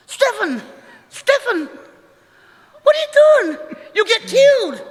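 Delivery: high, loud frantic cry; mother shrieking at her son by the lions; panicked shout. Retold or imitated. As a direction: scream at him in alarm like a terrified mum. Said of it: "she's freaking out".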